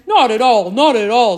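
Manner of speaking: polite giant voice. A narrator's reading. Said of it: "said the giant".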